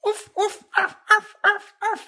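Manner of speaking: high yipping little dog barks; yappy panting puppy. Acted. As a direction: yip like a tiny excited puppy. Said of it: "you hear just kinda like this".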